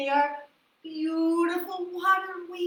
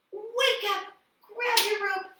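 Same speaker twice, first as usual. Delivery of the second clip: higher bright sing-song wake up; cheerful wake-up call; 7 semitones higher than usual. Shown as a demonstration. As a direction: call them awake with energy. Pretend waking up, cheerful call.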